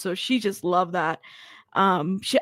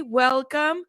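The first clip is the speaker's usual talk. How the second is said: ordinary delivery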